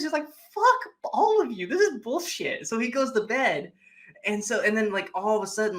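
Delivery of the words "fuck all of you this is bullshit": much higher, slow, exasperated; hammed-up furious guy; mock fed-up outburst. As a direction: spit it out, sick of them all. Piper fed up.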